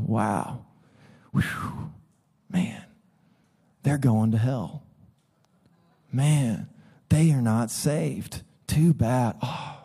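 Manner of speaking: soft, sighing fake sympathy; mock pious pity. Pastor mocking judgmental churchgoers, soft fake pity. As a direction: sigh it like a smug believer pretending to care.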